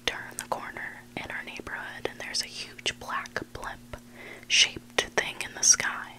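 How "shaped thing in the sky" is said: ordinary delivery, nothing marked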